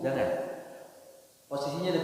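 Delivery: normal talking voice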